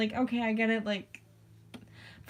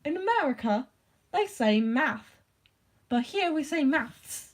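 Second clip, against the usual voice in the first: put-on British accent, pitched higher in places; mock British accent; 5 semitones higher than usual. Imitating British people explaining maths, mock British accent. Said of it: "'cause they're like".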